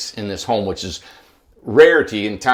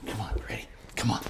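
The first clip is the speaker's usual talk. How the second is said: hushed coaxing whisper; 3 semitones lower, 15 dB quieter, faster than usual. Real, not acted. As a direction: whisper to the dog.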